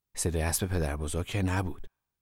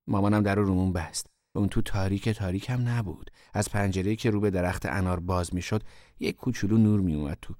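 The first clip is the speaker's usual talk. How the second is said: usual speaking voice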